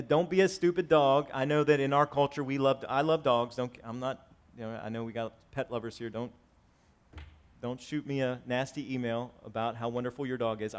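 normal talking voice

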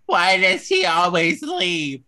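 high, loud, drawn-out mock wail; hammed-up crying complaint; imitated crying. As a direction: whine it slowly like a tearful girlfriend. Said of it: "then she starts crying".

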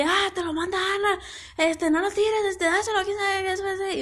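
a fan gushing her message to the idol; imitating her sister. (imitando a la hermana, aguda y atropellada)

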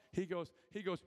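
normal talking voice

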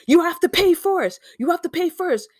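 high-pitched impression of an insistent older man; imitating the yelling delivery man. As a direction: mimic the driver demanding payment. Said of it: "He starts".

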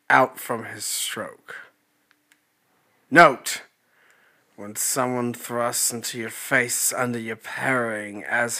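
slow, measured Shakespearean reading; Shakespearean accent. Acted. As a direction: read it like an old Shakespearean manual, slowly. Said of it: "more like a Shakespearean".

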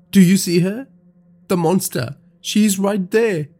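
high, frantic cry of terror; panicked. Acted. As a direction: point and shout in panic. Sees the monster, panics.

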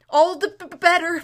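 loud, breathless panting gasp. A narrator's reading. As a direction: gasp it out mid-gallop. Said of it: "panted Bree".

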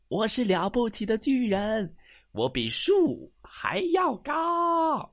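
high, loud mock-menacing giant; a playful storybook threat; booming giant voice. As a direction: boast like a mighty giant.

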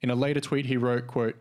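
ordinary talk

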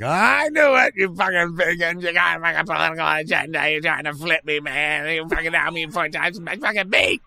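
loud, high, furious meat-eater rant; angry rant. Acted. Meat eater accusing vegans, loud high angry rant. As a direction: yell it like an outraged carnivore. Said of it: "People are like".

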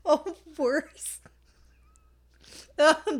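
real helpless laughter breaking up the words; laughing so hard it nearly turns to crying; laughing near tears. Real, not acted. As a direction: fight back tears of laughter while talking. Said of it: "trying not to cry because I'm laughing".

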